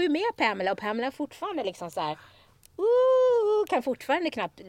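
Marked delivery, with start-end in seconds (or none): 2.8-3.7 put-on drunk slur. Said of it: "sluddrar hon bara".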